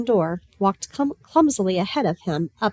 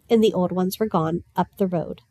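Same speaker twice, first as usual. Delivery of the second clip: usual speaking voice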